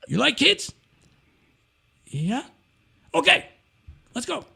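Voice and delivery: high, pushy old man, lightly voiced; intense old boss. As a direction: bark orders like a brisk old headmaster. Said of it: "intense Iron Man".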